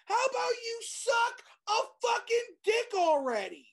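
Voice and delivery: real exasperated yell at a TV show; furious rant. Real, not acted. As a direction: let the frustration explode. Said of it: "I'm mad".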